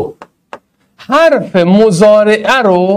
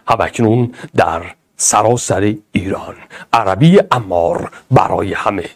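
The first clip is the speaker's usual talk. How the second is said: announcer voice; 9 semitones lower, 4 dB quieter than usual. Pretend. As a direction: boom it out like a TV promo. Mock announcer intro line.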